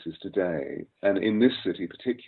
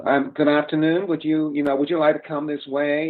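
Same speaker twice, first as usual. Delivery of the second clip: higher, nasal modern American accent; 8 semitones higher than usual. Shown as a demonstration. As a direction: squeeze it up into the nose like today's Americans. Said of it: "it's getting really, really nasal".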